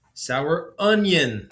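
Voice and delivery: high, slow small voice; a willowy child insisting. A narrator's reading. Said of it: "the voice said again".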